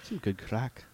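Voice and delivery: put-on Irish accent. Playing along in Irish.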